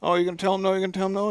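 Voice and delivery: high, rapid mock auctioneer pitch; auctioneer patter. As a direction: rattle it off like an auctioneer working the crowd. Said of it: "doing the auctioneer thing".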